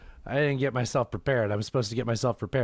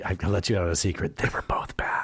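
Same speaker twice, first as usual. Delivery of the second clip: secret whisper; 6 semitones lower than usual. Pretend. Host whispering a secret about Denny's to cohost, whispered.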